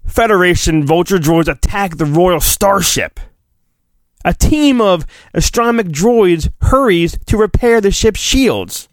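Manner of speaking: ordinary delivery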